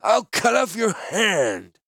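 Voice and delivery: slow, high, loud threat; furious merchant. Acted. Angry wine seller threatens the thief slowly and loudly. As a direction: drag out each word in rage.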